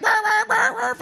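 yelping bark, pitched far up; mock dog barking. Pastor imitating his dog stuck in the fence, yelping high. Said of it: "he's like".

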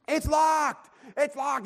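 high-pitched mock tantrum yell; imitated child screaming. Son finds the snack closet locked, imitated high scream. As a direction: wail it like a frantic five-year-old. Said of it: "He's screaming".

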